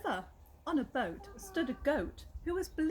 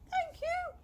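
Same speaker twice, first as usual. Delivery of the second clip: very high squeaky mice; squeaky thanks. Acted. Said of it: "they squeaked".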